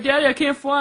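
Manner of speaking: put-on high little boy complaining to his dad; a small son whining after the fall; imagined kid voice. Son on the ground telling his dad, high and whiny. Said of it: "he's like".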